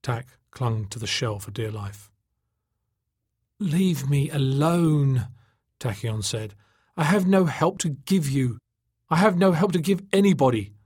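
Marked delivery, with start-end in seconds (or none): 3.6-5.3 very slow, higher, wailed plea; miserable plea. A narrator's reading. Exhausted captive refuses, despairing. 7.0-10.7 high, tearful-sounding refusal; Tachyon, despairing. A narrator's reading.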